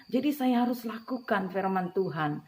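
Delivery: ordinary talk, nothing marked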